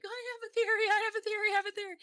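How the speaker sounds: high, eager repeated squeal; excited squeal. Retold or imitated. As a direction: blurt it out over and over, excited. Mocking chat message, excited.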